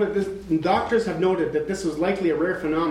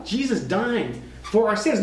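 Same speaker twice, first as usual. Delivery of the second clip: usual speaking voice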